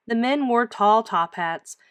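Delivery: ordinary delivery, nothing marked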